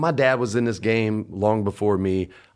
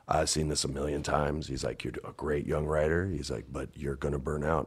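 quietly voicing Vince; 9 semitones lower, 11 dB quieter than usual. Vince voiced low and soft.

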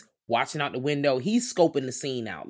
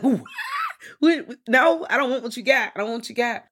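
high-pitched, loud acted rejection; mock indignant refusal; 10 semitones higher, 5 dB louder than usual. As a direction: squeal the refusal like an affronted man.

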